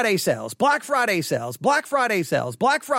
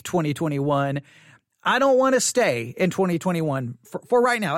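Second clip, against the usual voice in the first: ordinary delivery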